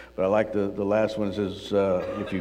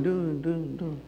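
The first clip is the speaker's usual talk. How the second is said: softer fading mock echo; cave echo; 5 semitones higher, 3 dB quieter, slower than usual. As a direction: repeat the word like a cave echo. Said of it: "There's a little bit of an echo".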